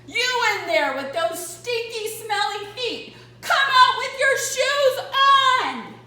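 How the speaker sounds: loud, high policeman shouting through a bullhorn. Acted. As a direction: blare it like a cop on a megaphone. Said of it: "shouted through a bullhorn".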